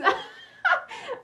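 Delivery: loud high-pitched laugh that falls away; real laugh at a viewer's joke; high burst of laughter. Real, not acted. Reacting to a funny comment.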